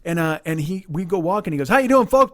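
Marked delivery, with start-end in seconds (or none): 1.7-2.3 loud, high, hearty greeting; enthusiastic stranger. Retold or imitated. Stranger greets two racers walking in, enthusiastic. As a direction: boom out an over-eager hello, pitched up. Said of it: "He's all enthusiastic and inspired".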